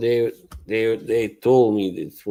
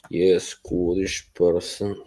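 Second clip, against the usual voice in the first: ordinary delivery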